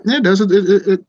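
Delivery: loud and heated; emphatic loud. Real, not acted. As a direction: raise your voice, insisting. Heated disagreement.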